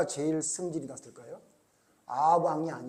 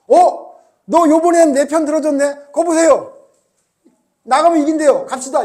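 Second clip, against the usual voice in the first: much higher, louder, gleeful surprise; delighted king; 10 semitones higher, 14 dB louder than usual. Retold or imitated. King Ahab delighted at the prophecy, excitedly. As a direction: cheer like you finally got your way. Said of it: "이래야 되는데 아합이".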